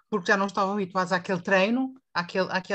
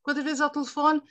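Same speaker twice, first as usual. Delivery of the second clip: ordinary delivery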